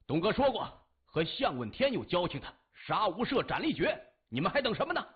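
loud, high-pitched commanding shout; shouted order. A narrator's reading. Ren Changfeng orders his men to attack, shouting. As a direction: shout it hard, urging your men on. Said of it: "任长风喝道".

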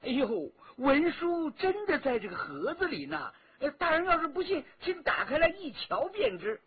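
high, whiny pleading; dwarf pleading with the guard; wheedling trickster. A narrator's reading. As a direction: play innocent. Dwarf protests innocence.

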